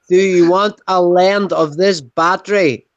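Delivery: slow, spelled-out repeat; exasperated repeat. Real, not acted. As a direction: say it slowly for someone who didn't catch it. Repeats slowly after not being understood.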